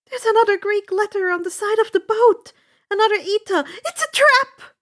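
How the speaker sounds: high, loud, fast alarm; rising panic, warn them; panicked shout. A narrator's reading. Annabeth shouting a panicked warning about the trap.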